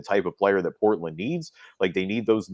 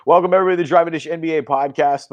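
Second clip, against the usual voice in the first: higher and louder, a bright welcome; podcast host intro; 8 semitones higher, 7 dB louder, faster than usual. Acted. Host welcoming listeners to the podcast, announcer style. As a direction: open the show like an announcer.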